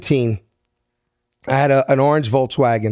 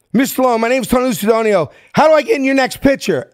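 eager call out; 9 semitones higher than usual. Retold or imitated. Kid calls to Stallone in the elevator.

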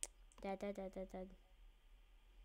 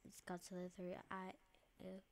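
whisper; 3 semitones lower, 17 dB quieter than usual. Acted. Naming the game secretly.

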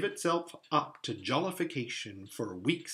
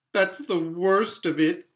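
groaned lament, a little raised in pitch; deep groan; 4 semitones higher, 6 dB louder than usual. A narrator's reading. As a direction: groan it out in misery. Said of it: "with a deep groan".

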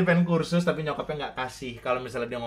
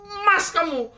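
laughing greedy mother; 13 semitones higher, 6 dB louder than usual. Acted.

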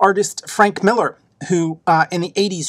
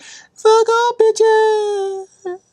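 mock battle yell; much slower than usual. Spartan kicking a guy in the well, high mock screech. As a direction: belt it out in falsetto and hold it. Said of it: "kicks a guy in the well, like".

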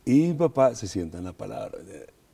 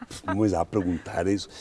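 mock bravado; 4 semitones lower, 6 dB louder than usual.

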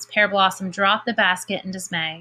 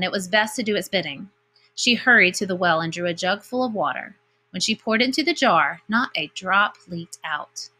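plain reading voice

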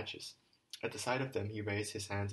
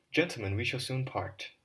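put-on louder, earnest address to the boys; formal speech; 5 dB louder than usual. Alyosha addresses the schoolboys by the stone, earnest speech. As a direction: speak to them as a solemn farewell.

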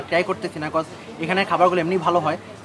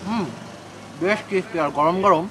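speaking between chewing motions; talking while chewing. Real, not acted. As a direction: mouth partly full of food. Seen 3: Bites jilapi at -1; lips pursed, chewing while speaking.

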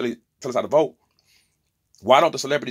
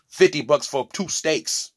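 ordinary talk, nothing marked

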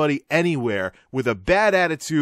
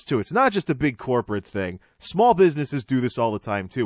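ordinary delivery

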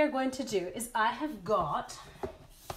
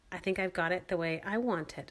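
ordinary talk, nothing marked

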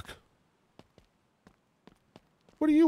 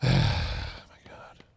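breathless whisper under his breath; whispered dismay. Real, not acted. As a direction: whisper it to himself, defeated. Dismay at the sand problem.

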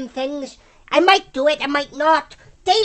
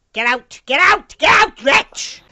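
angry order; 2 semitones higher, 5 dB louder, slower than usual. Real, not acted. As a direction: yell at him to clear off. Ordering teammate out.

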